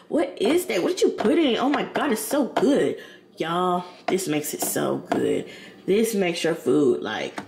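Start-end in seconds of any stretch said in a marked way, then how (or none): 0.1-3.0 high-pitched mock amazement; imitated amazed eaters. Imitating everybody tasting her food, excited amazement. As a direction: gush like thrilled dinner guests, pitched up. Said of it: "Everybody like".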